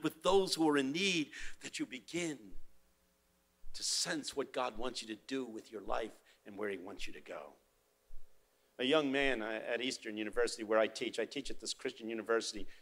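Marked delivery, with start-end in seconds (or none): none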